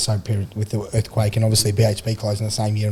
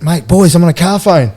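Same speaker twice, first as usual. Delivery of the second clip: loud, higher-pitched excited announcement; excited bragging; 6 semitones higher, 7 dB louder than usual. Retold or imitated. Drunk player boasting to radio hosts on a car phone, excited. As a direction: proclaim it down the line.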